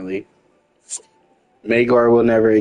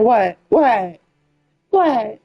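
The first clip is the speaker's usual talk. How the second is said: mock disbelief; 11 semitones higher, slower than usual. Incredulous repeated what.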